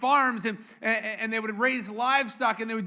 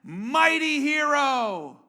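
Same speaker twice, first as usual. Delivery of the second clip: slow, booming mighty proclamation, higher and louder; angel's greeting; 4 semitones higher, 5 dB louder, much slower than usual. Acted. Angel of the Lord greets hiding Gideon, grand slow proclamation. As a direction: hail him grandly, drawing out each word. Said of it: "the angel of the Lord appears to him and says".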